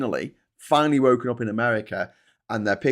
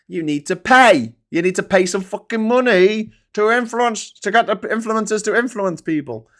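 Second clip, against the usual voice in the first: loud, high, hammering the point; emphatic rant; 10 semitones higher, 6 dB louder than usual. Real, not acted. Host telling friends influence costs money, loud. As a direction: bang home that you need to pay.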